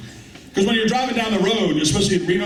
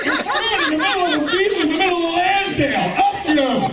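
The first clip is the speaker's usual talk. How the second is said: laughing mock police holler; cop shouting orders; 13 semitones higher than usual. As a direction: bark it like a cop in a field. Cop yelling at suspects, shouting.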